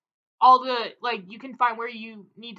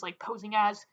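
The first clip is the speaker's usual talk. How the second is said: ordinary talk, nothing marked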